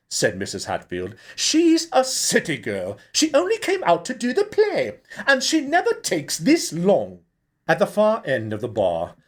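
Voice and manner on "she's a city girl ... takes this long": very high, louder fussy woman; fretful director. A narrator's reading. Mrs Hatfield answering the barmaid, high and fretful. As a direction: flutter the no up high.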